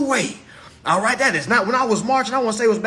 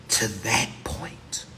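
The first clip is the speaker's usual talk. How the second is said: whispering; 11 semitones lower, 6 dB quieter, much slower than usual. Real, not acted. Hushed aside.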